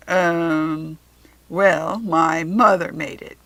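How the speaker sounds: hesitant, embarrassed Jack Frost answer; flustered Jack Frost. A narrator's reading. Jack Frost caught off guard by a boy's compliment, bashful.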